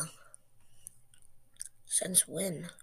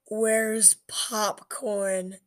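slow drawn-out punchline; 2 semitones higher, 5 dB louder, much slower than usual. Acted.

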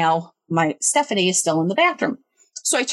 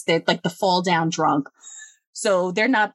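usual speaking voice

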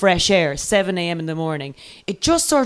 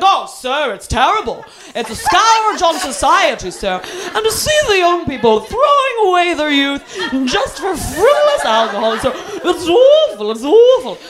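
high, drawn-out, hammed-up lament; mock outrage; 9 semitones higher than usual. Drunk passenger deploring drink to the driver, melodramatically. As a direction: overdo the horror like a drunk feigning sobriety.

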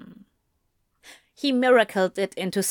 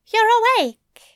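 much higher, louder character voice; high-pitched, gently meant greeting; Aziraphale; 13 semitones higher, 6 dB louder than usual. A narrator's reading. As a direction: pitch it well up, as a different person. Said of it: "Aziraphale's soft voice sounded behind him".

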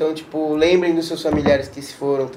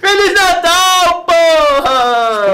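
shouting; 11 semitones higher, 4 dB louder, much slower than usual. Pretend. Shouted joke greeting.

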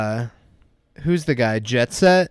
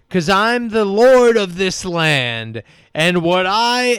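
pompous landlord; 7 semitones higher, 3 dB louder, slower than usual. Retold or imitated. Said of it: "And he goes".

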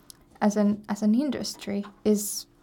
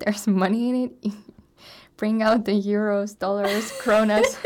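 laughing, louder with each currency; 6 dB louder than usual. Real, not acted. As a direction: giggle through the list. Joking about money in UX.

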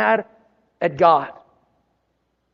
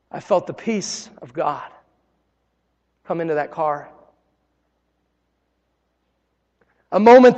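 hushed peace. Real, not acted. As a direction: let the voice settle into calm. Describes God's peace arriving.

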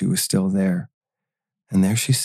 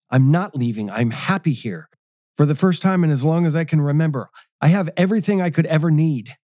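higher, insistent and heated; 7 semitones higher than usual. A narrator's reading. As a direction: refuse to leave, voice climbing. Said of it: "he fumed".

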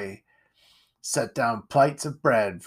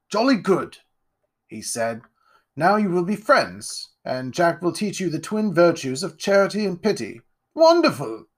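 higher, grand aristocrat voice; pompous lord; 6 semitones higher, 4 dB louder than usual. Acted. Lord Ironwood greeting Jack, pompous and grand. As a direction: puff yourself up like a self-satisfied lord.

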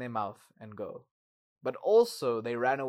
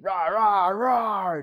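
put-on kid roaring; 7 semitones higher, 10 dB louder, much slower than usual. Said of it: "Charlie is busy going".

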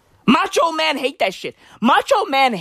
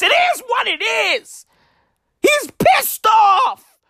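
mock angry shout; 9 semitones higher, slower than usual. Ranting about angry Chris Pratt.

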